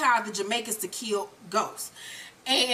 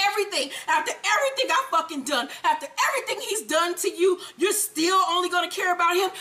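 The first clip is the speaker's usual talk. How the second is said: higher, pleading mock tirade; going off; 5 semitones higher, faster than usual. Holly going off on Tommy for choosing Ghost, high aggrieved rant. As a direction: imitate a hurt lover's high, rushing rant. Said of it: "she's going off on him".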